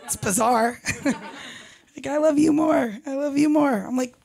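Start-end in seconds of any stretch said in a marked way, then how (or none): 2.1-3.7 higher, mock lovey one-upping; mock cutesy play fight; play-fighting couple. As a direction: imitate a couple sweetly bickering. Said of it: "play fight over who loves".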